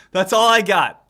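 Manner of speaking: loud, through laughter; laughing. Real, not acted. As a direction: burst it out loud while cracking up. Cracking up at own demo.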